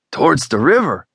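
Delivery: rising alarmed question; alarmed exclamation. Acted. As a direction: blurt it out in alarm. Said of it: "Juan exclaimed".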